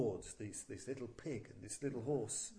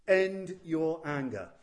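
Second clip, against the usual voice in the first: louder, slow command; terrible commanding voice; 9 dB louder, slower than usual. Acted. A mighty voice commands Rudra to stop, slow and loud. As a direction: hand down the order with slow, heavy authority. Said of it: "a terrible voice resounded".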